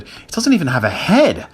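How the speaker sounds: high, horrified disbelief; rising incredulous alarm; shuddering. A narrator's reading. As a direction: protest in creeped-out amazement. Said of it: "Jack shuddered".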